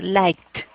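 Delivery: "liked" in one syllable ending in a T. Shown as a demonstration. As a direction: say "liked" slowly with a crisp final T sound. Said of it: "va a sonar como una T". ("liked" con la ED como T)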